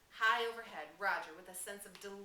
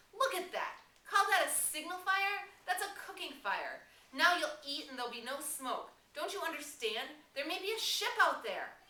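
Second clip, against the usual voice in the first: very high, loud, outraged yell; furious scolding; 9 semitones higher, 8 dB louder than usual. Acted. Ralph berating the tribe over the useless fire, furious. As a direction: shame them for the cooking fire. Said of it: "A gust of rage shook Ralph".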